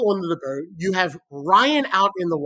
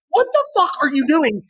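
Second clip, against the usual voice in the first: higher, exasperated retold yell; 7 semitones higher than usual. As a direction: yell at the friends to hurry. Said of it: "I'm like".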